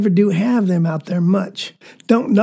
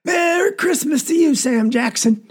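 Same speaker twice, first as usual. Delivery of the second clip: calling out; 6 semitones higher than usual. Retold or imitated. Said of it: "we hear Papa call out".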